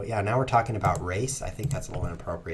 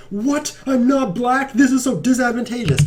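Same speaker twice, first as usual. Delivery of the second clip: mock whiny complaint; 9 dB louder than usual. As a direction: play a sulky child protesting his bad luck. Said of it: "I'm like".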